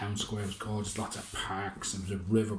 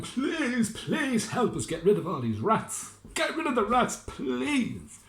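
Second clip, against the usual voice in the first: begging villagers, pitched way up; pleading townsfolk; 13 semitones higher, slower than usual. Acted. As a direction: beg him desperately in a high voice. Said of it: "people would beg him".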